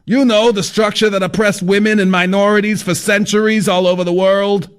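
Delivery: high, brash know-it-all; fat man voice. A narrator's reading.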